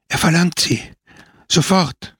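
put-on an old servant gasping out an urgent call; panting. A narrator's reading. Old servant gasps to the priest, breathless and urgent. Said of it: "keuchte die Alte".

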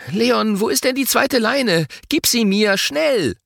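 put-on high, hurried-sounding child's demand; high-pitched urgent plea. A narrator's reading. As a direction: demand it in a panic against the clock. Urgent demand in timed contest.

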